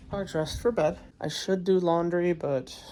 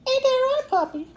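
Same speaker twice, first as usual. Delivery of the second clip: high cooing pet voice; 5 dB louder than usual. Real, not acted. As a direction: coo at the puppy. Talking to the dog.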